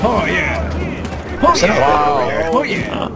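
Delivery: excited. Real, not acted. Excited in-game.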